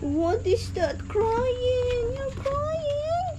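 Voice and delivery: very high, mock-sympathetic baby talk; baby talk to pet. Asking her whining pet if it's crying, in high baby talk.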